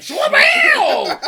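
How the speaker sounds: high, loud laughing whoosh noise; mock swoosh. Cops swarming the drug bus, loud high whoosh. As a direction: blast out a swooping sound effect.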